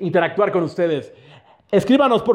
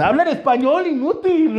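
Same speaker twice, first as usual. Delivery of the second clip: scolding; 7 semitones higher than usual. Acted. Scolding the friend voice.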